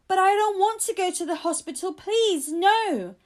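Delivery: high, loud frightened protest; child shouting in fear of hospital; scared shout. A narrator's reading. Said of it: "I shouted".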